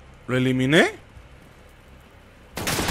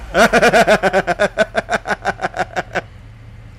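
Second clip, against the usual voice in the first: light laugh; 3 semitones higher than usual. Real, not acted. Laughs at own confusion.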